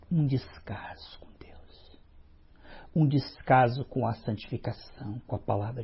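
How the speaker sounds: slow whisper of sorrow; whispered lament. Real, not acted. Preacher laments neglect of God to the congregation, slow whisper. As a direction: breathe it out slowly, grieving.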